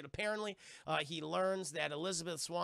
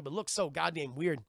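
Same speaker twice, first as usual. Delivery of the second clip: normal talking voice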